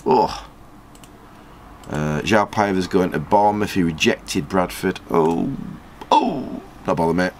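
ordinary talk, nothing marked

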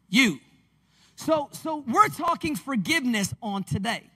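ordinary delivery

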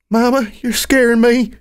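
put-on high-pitched little kid voice; scared child Serenity. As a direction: plead with Mama in a high child's voice. Frightened child pleads with mother.

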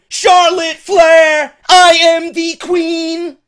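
loud, high, slow proclaiming shout; mock grand announcement. Vlogger mimicking Charlotte's boastful entrance, slow hammed-up shout. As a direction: bellow it like a self-crowned queen.

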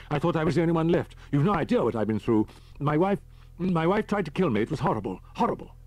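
high, loud anguished outpouring; broken, distraught. A narrator's reading. Man tells Holman his wife attacked him, broken and distraught. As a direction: pour it out brokenly, pitched high. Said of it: "he said brokenly".

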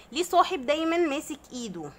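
ordinary delivery

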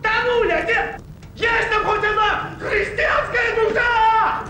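кричит, что тонет; зовёт на помощь изо всех сил; отчаянный крик. Acted. (отчаянный крик тонущего)

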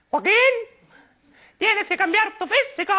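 otherworldly high-pitched woman's impression; Gracita Morales. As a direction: pipe it out in a piercingly high register. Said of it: "Era Gracita Morales".

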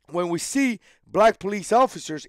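ordinary delivery

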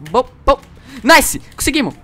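excited cheer. Real, not acted. Celebrating a game win.

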